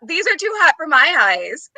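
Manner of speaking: a British-accented line, retold while laughing; giggling as she repeats the overheard comment; laughing quote. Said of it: "she heard the".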